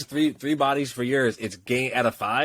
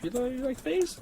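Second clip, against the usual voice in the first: curious stranger; 13 semitones higher, 5 dB quieter than usual. Retold or imitated. Imitating the guy asking.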